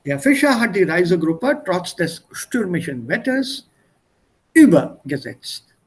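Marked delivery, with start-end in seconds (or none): 4.5-5.7 "übergesetzt" with the weight on "über", slow and louder; stress on first syllable. Shown as a demonstration. Teacher showing a student where the stress falls, punching the first syllable of "übergesetzt". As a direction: lean hard into "ü-" of "übergesetzt", then let "gesetzt" fall away. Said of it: "Stress is on the first syllable".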